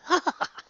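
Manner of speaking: short, high performed laugh; acted laugh. A narrator's reading. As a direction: burst into a brief high laugh in character. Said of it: "Rarity asked with a laugh".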